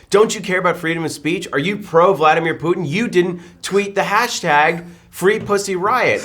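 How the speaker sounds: high, mock outraged accusation; self-righteous. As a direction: imitate a preachy hashtag shamer, leaning on the words. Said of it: "self-righteous tone of voice that everybody got".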